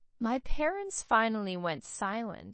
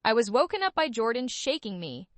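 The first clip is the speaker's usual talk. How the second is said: ordinary delivery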